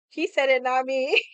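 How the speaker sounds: loud, high mock protest; playful disclaimer. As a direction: call it out high and loud, disowning the remark. Playful disclaimer in retold banter.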